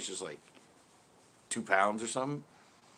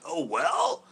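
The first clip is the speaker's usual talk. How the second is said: higher, rising, questioning mimicry; incredulous echo; 4 semitones higher, 4 dB louder than usual. Pro echoing angler's shrug, incredulous. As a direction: throw it back in mock disbelief.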